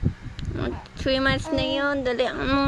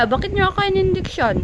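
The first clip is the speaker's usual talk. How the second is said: higher-pitched pretend baby's complaint; speaking for the baby; 7 semitones higher than usual. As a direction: lightly voice the baby's protest. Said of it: "Ano sabihin mo sa kanila?". (kunwaring boses ng sanggol na nagrereklamo)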